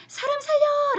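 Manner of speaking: high, loud, slow scream of help me; mock cry for help. Wife acting out her fake scream for her husband, slow and high. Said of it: "라고 소리를 질렀더니".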